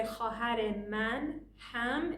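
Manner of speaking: Khahar man ham said slowly, word by word; slow careful Persian; slow and separate. Shown as a demonstration. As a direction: spell out each word slowly. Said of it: "you say".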